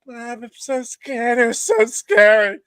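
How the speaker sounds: high, slow, hammed-up fright; mock scared. Telling mom it was scary, high mock-scared wail. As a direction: act like a frightened kid whining to mom.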